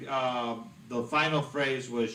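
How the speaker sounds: ordinary delivery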